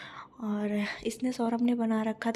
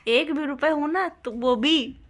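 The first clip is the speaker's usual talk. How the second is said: louder and higher, then slow and insistent; heated argument; 4 semitones higher, 8 dB louder than usual. Real, not acted. Wife arguing with husband over where money came from, heated. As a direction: press the point, annoyed.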